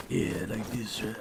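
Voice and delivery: low, soft wrestler impression; Undertaker. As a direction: attempt a deep, half-hearted wrestler voice. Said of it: "he just sounds like he's".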